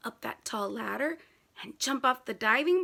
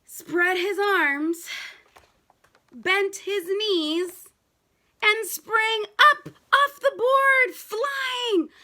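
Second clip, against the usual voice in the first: slow then much louder and higher shout; building excitement; 9 semitones higher, 9 dB louder, much slower than usual. A narrator's reading. Narrating the boy's leap to kids, rising to a shout. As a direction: build to a soaring cry. Climax of the jump in story.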